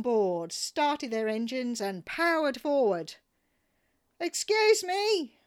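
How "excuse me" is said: slow, loud, very high shriek. Retold or imitated. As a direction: shriek to stop the men. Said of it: "I shrieked".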